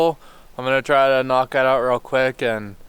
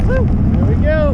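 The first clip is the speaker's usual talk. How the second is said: excited; 7 dB louder than usual. Real, not acted. Whoop in wind.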